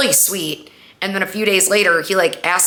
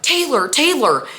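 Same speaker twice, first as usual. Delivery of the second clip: high-pitched, urgent calling of a name; mock-frantic high voice trying to rouse someone; imitated panic; 9 semitones higher than usual. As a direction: act out his panicked calling. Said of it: "and he's panicking".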